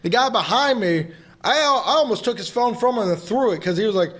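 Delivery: ordinary delivery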